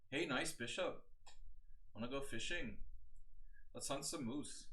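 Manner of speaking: playful Canadian accent, bit put-on; mock Canadian accent. Streamer teasing the chess bishop in a mock Canadian accent. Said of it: "I'm trying to practice my Canadian accent".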